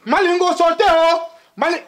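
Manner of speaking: high-pitched exclamation of shock; shocked shouting. Acted. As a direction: cry it out to all around. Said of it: "no shout, no landlord".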